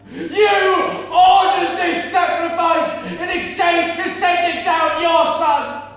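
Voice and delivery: loud, high, slow accusations hurled skyward; furious shouted curses. Retold or imitated. Grieving mother cursing God for the slaughter, raging shout. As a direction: rage at God, every word dragged out. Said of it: "I shouted".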